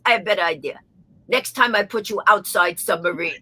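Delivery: deadpan mock Russian pilot; dry threat in a Russian accent. Russian pilot threatening the annoying passenger, deadpan Russian accent. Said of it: "the heavy Russian accent".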